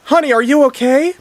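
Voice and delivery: high, loud worried question; acted wifely panic; panicked. A narrator's reading. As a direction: cry out to a stricken husband in alarm. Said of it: "panicked Missus Lorraine".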